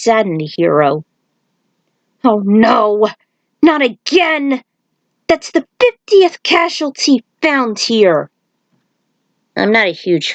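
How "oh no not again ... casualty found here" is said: high, slow, alarmed outburst; dismayed cry. Acted. Stryker finding more bodies on the street, high and dismayed. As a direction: cry it out at the wreckage.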